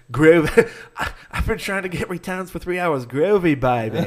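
laughing through a terrible mock British accent; bad Austin Powers. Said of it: "terrible Austin Powers accent".

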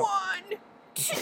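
very high squeaky counting; mock coach count.

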